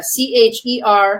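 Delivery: "C H E R" spelled slowly letter by letter; spelling it out. Shown as a demonstration. ESL teacher spelling a new word for listeners, slow. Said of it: "and that word is cherophobia".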